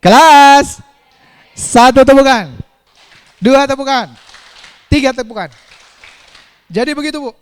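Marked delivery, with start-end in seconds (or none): 0.0-0.8 loud call for attention; calling the class. Real, not acted. Teacher calling out loudly to get the class's attention. 1.5-5.5 loud counted clap cues; counting claps aloud. Real, not acted. Teacher loudly counting claps for the class, fading down.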